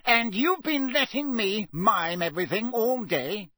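high-pitched indignant complaint; Fudge outraged. A narrator's reading. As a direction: protest as if badly affronted. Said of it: "sounding outraged".

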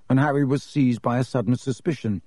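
plain reading voice

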